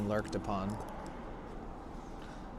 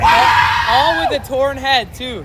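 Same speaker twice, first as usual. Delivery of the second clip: shouting; 8 semitones higher, 6 dB louder than usual. Real, not acted. Bragging shout about the win.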